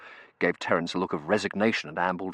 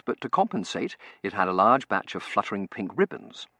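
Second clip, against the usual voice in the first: ordinary delivery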